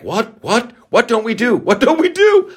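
mock bewildered, rising to a squeak; baffled disbelief. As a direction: climb in pitch as the confusion grows. Confused reply to the host.

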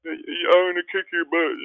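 high, loud mock taunt; hammed-up high-pitched trash talk. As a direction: yelp it like a silly playground threat. Mock trash talk to mouthpiece fitter.

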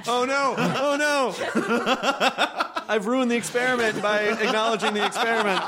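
high laughing exclamation. Real, not acted. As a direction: laugh through the words. Laughing at self-conscious joke.

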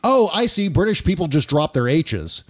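ordinary delivery

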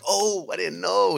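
high, slow dawning "oh"; relieved gym teacher. Retold or imitated. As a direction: let the realisation sink in.

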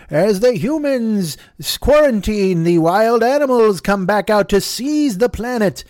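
solemn documentary pastiche, drawn out; mock nature documentary narrator. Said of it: "everybody's like".

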